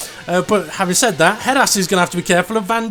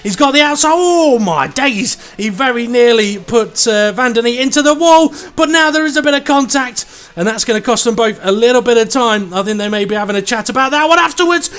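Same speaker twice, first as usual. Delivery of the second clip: excited commentary; 5 semitones higher, 4 dB louder than usual. Real, not acted. Live race incident commentary.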